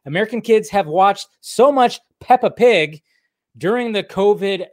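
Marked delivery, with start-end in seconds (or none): none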